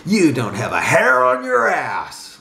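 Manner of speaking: hooting, scornful put-down; laughing cowboy taunt. A narrator's reading. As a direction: jeer like a cowboy, stretching the final insult. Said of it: "laughed his friend like a cowboy".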